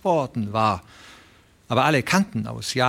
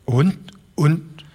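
higher, halting, tripping over the words; stammering old man; 6 semitones higher than usual. A narrator's reading. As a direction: stumble out the question, pitched up. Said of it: "stammelte der alte Broderson".

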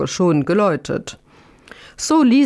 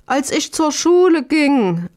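higher-pitched, lilting reply; honest old man's raised-pitch recollection; innocent answer; 7 semitones higher than usual. A narrator's reading. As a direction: answer brightly up high, then let it fall. Honest old man answers the landowner.

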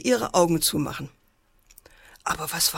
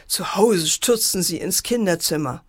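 plain reading voice

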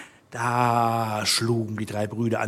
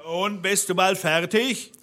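higher-pitched call from below; impatient call; 7 semitones higher than usual. A narrator's reading. Bluebeard calling upstairs to his wife, impatient. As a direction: call up impatiently without much volume. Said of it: "rief der Blaubart unten".

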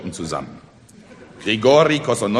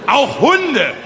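high, loud exclamation; booming orator's addition; emphatic boast; 8 dB louder than usual. Acted. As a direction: proclaim it to the whole crowd. Orator proudly tops his story.

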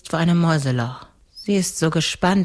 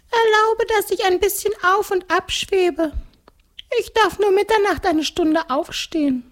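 very high-pitched ghost voice. Acted. (hohe Gespensterstimme)

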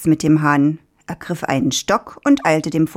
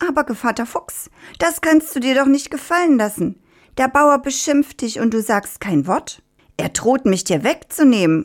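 higher, needling rooster; 8 semitones higher than usual. Acted. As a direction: keep goading the fox as the rooster. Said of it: "ließ nicht locker". (hohe, stichelnde Hahnenstimme)

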